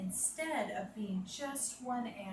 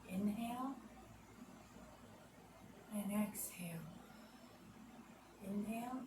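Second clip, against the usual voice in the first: guided relaxation; 3 dB quieter than usual. Acted. Yoga teacher guiding children, calm and soft. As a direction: lull the kids into calm breathing.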